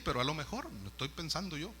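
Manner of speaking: ordinary talk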